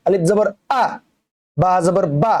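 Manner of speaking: আ and বা cut short, with no stretch; short vowel. Shown as a demonstration. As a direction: read আলিফ জবর আ, বা জবর বা with quick short endings. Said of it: "এভাবে পড়া লাগবে".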